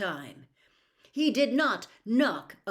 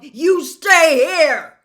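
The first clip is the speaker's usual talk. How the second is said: furious yell, much higher and louder, slow; angry shout; 9 semitones higher, 19 dB louder, much slower than usual. Acted. Harry shouting at Trelawney to stay behind, furious yell. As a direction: bellow it at her, furious. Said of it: "Harry repeated angrily".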